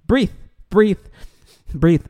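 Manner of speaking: retold firm instruction, each word separate; coaching to breathe. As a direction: imitate coaching a crying child to breathe. Retold coaching of crying son.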